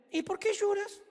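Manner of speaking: high, gentle, wondering question; gentle angel asking. Acted. Angel questions weeping Mary.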